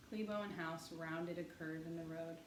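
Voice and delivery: ordinary delivery, nothing marked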